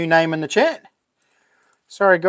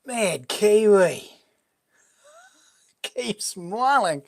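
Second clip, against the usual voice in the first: stunned; 3 semitones higher, much slower than usual. Real, not acted. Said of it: "I'm a bit lost for words".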